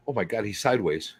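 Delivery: ordinary talk, nothing marked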